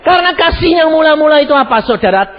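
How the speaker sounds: loud, high preaching call; fiery sermon voice. Acted. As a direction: preach it out to the hall.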